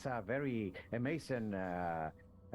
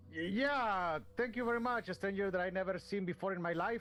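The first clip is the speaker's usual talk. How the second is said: put-on higher, lilting foreign-accented player character; Wyoming's odd foreign accent, a touch higher; 8 semitones higher than usual. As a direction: adventurer thanking the shopkeeper in his put-on accent. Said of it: "the accent is, it was an issue".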